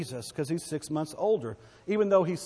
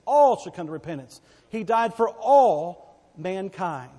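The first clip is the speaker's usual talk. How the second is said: loud fervent preaching; rising revival preacher cry; 4 semitones higher, 6 dB louder than usual. Acted. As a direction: preach it out with full force. Preaching at full force.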